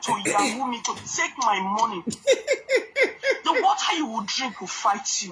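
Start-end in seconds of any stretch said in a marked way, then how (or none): 2.1-5.3 slow, drawn-out curse with a laugh in it; slow mock curse. Real, not acted. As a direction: curse them slowly, half laughing. Curse spoken slowly, laughing.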